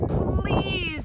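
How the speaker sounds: high strained plea; pleading. Acted. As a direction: beg the pig. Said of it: "said Mister Watson".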